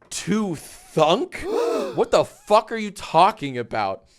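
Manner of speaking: gasping, shocked and indignant; appalled at what people say; outraged disbelief. Real, not acted.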